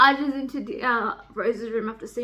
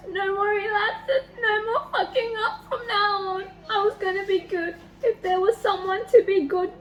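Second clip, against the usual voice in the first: tearful reading; 7 semitones higher than usual. Real, not acted. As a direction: read it through tears. Reading Dean's line while crying.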